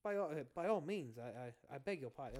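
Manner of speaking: mildly posh British courtesy. Shown as a demonstration. Host demonstrates an easy accent, posh British. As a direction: offer it as a well-mannered posh Brit. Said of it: "Posh British is just very".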